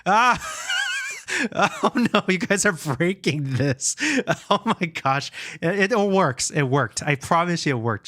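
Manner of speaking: high-pitched, real panicked laughing. Real, not acted. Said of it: "that made me panic a little bit".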